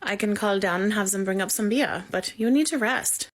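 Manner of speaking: German nurse's accent, lightly voiced. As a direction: play the firm German nurse, a hint of accent. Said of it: "She hit in an accent".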